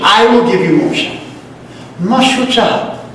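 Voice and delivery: ordinary delivery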